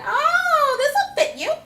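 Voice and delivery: high, loud chirpy mock voice; squealed mock gift-giver voice. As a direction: chirp it like a careless relative.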